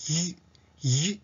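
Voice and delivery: ゆ as a tight English Y glide. Shown as a demonstration. Teacher showing the English Y sound, tense palatal glide. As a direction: raise the tongue hard and squeeze out ゆ. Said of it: "舌をぐっと上げて".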